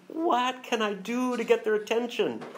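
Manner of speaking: high pleading cry of God; voicing God's plea. Preacher voicing God pleading for attention, mock lament.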